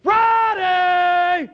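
high, drawn-out shout; yelled call. Retold or imitated. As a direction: yell it out to the whole church. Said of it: "yelled out one word".

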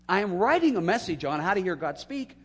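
high, loud realization; rising amazed exclamation. Real, not acted. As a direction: shout out the irony, high. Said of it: "what's ironic about that".

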